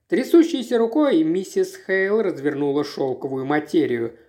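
ordinary delivery

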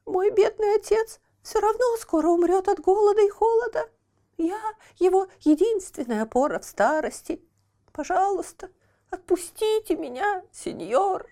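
put-on high lamenting child voice; pitiful puppet boy. A narrator's reading. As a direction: plead in a high thin voice. Buratino voiced in story.

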